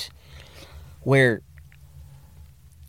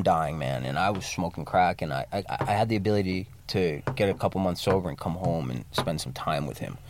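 quiet and subdued; 7 semitones lower, 5 dB quieter than usual. Real, not acted. Retelling his father's dying.

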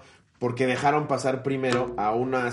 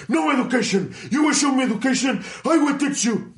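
high mock-angry English; imitating the rude man threatening Ricardo, much higher; mocking the caveman; 10 semitones higher than usual. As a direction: lecture back like a pompous brute. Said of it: "Y le dice".

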